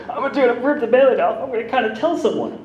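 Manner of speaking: high, loud psyching-up voice; nervous bravado, voice jumps up; pumped-up self-talk. Retold or imitated. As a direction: hype yourself up to spill it. Psyching himself up to confess.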